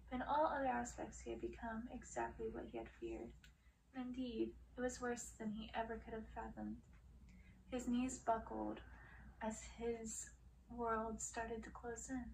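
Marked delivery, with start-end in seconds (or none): none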